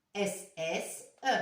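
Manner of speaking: normal talking voice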